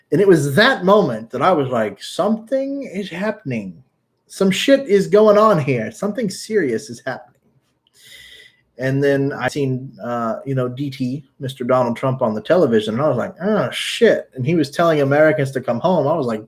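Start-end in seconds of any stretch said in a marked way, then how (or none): 2.0-7.4 retold moment of alarm; dawning alarm. Acts out his dawning alarm. 13.4-14.3 high-pitched retold oh-no moment; acted-out reaction to bad news; mock dawning realization. Retells reaction to Trump's warning.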